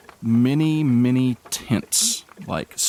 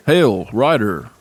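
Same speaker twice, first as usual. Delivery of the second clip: guard voice; 4 dB louder, slower than usual. Acted.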